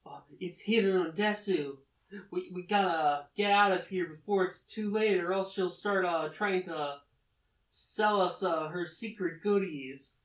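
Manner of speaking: urgent, play-acted alarm; freaking out. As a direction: retell a frantic warning. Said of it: "Tsukasa's kinda freaking out".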